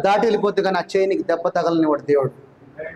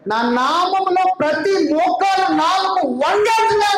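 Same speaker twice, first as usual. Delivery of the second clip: slow booming pulpit shout; proclaiming shout; 9 semitones higher, slower than usual. Acted. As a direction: thunder it out slowly like a decree. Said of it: "అన్నాడు".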